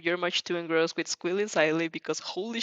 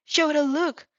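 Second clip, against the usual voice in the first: excited tease; 9 semitones higher, 4 dB louder, slower than usual. Acted. Midnight teasing Aizawa.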